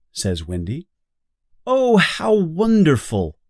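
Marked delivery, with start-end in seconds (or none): 1.7-3.4 high, thrilled cry of joy; delighted. Acted. Lost Boys hear they can live in London, delighted. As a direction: squeal it like overjoyed kids.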